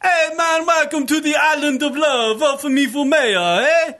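a politician pandering in a loud mock Jamaican voice at a parade; Anthony Weiner's Jamaican accent. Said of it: "I hear Anthony Weiner going".

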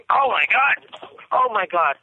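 sudden high gasp of shock; Oh my God, truly stunned. Real, not acted. Just saw a dog killed.

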